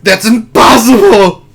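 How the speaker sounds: loud, high, drawn-out movie cry; hammed-up howl of denial; mock anguished yell. Player quoting old Luke ready to die, anguished yell. Acts out Luke's famous cry.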